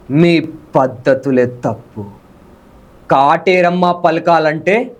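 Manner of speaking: slow, weighty movie dialogue delivery; dramatic Telugu movie dialogue on request; filmy villain dialogue. Shown as a demonstration. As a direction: deliver it like a menacing film hero line, slowly. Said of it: "ఒకసారి dialogue చెప్పవా".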